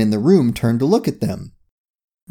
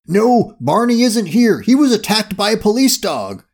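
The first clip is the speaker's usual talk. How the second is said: raised, higher-pitched crook's voice; crook on the phone; 8 semitones higher than usual. A narrator's reading. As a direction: report urgently to the boss.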